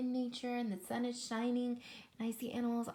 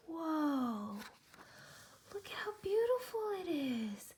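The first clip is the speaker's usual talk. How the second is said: amazed wonder; 6 semitones higher than usual. Real, not acted. Marvelling at picture with kids.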